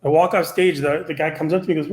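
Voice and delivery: ordinary delivery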